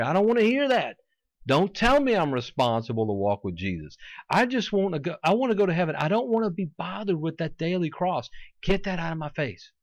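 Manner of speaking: high-pitched, whiny-defiant mimicry of refusers; mock stubborn refusal. Preacher imitating people who stop their ears to doctrine, mock refusal. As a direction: protest as if blocking your ears. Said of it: "They don't wanna hear. It's like this".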